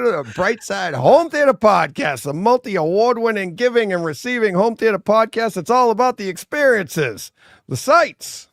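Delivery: high, loud show-intro announcer voice; podcast announcer. Acted. Host opens the podcast for listeners in a loud announcer voice. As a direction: sell the show like a hyped host.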